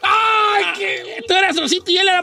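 squealing delight. Real, not acted. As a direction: gush over how cute it is. Reacción a apodos tiernos. (chillido agudo de ternura)

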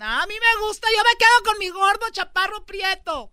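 high, loud mocking woman voice; mock girly voice. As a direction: squeal it like a picky woman. Mocking what some women say.

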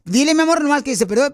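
agudo y fuerte, emocionado; empújala con emoción a la broma; entusiasmo. Real, not acted. Host coaching the caller excitedly. (arengando emocionado a la oyente)

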